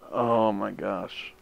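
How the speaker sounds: slow, drawn-out disbelief; exasperated groan. Real, not acted. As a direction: groan it out slowly. Still exasperated.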